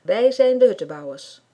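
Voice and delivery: loud, proud call to the whole group. A narrator's reading. Club leader rallies the hut builders, loud call. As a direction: shout it out like a rallying cry. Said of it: "roept hij".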